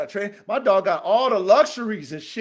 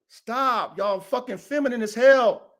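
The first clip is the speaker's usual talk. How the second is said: usual speaking voice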